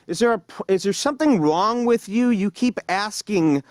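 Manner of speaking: mock outburst at the clerk; fed-up mimicry of himself; exasperated. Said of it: "And I go".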